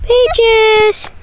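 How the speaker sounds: high sing-song call to the dog. Real, not acted. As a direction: call out to her pet brightly. Calling the dog.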